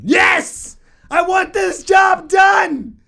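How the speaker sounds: high, loud, slow furious shout; angry shout. Acted. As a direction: bellow it in exasperation. Shouting at plumber.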